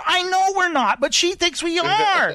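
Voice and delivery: high-pitched, insistent retold argument; exasperated retort. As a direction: insist in a high voice, dragging the last words. Acted argument with friend.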